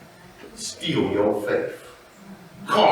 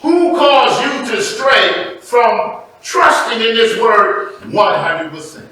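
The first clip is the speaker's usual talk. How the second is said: loud, raised-pitch sermon exhortation; preaching at full force; 9 semitones higher, 8 dB louder, slower than usual. Real, not acted. Preacher warning his people against added rules, forceful preaching. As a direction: drive it home to the congregation.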